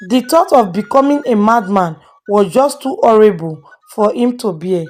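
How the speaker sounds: ordinary delivery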